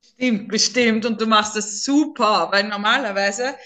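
normal talking voice

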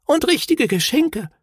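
very high little voice; marvelling small creature. A narrator's reading. Another little relative admiring presents, high and marvelling. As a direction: pipe up high with wonder.

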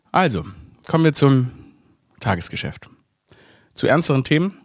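ordinary delivery

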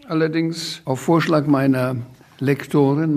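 usual speaking voice

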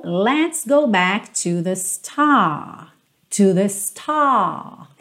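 "star" with no T at the end; slow lyric, "star" ending open; dropped final T. Shown as a demonstration. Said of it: "esses T's finais não tem".

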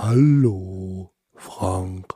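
low, drawn-out cartoon elephant voice; Benjamin Blümchen. Podcast host greeting Frank with his shaky-skilled impression, cartoon elephant voice. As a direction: play a slow elephant begging for a treat. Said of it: "Blümchen-Imitation".

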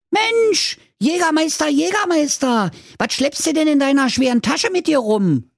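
very high, excited piglet voice; little pig. A narrator's reading. Marzipeter hails the laden huntsman, piglet voice. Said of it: "Marzipeter sprach zu ihm".

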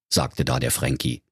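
usual speaking voice

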